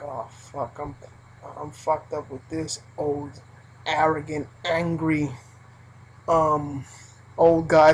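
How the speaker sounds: low, soft, weary complaint; low weary coworker dreading a customer; dreading groan. Retold or imitated. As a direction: grumble it in dread.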